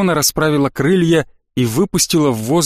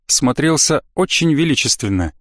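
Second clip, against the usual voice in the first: usual speaking voice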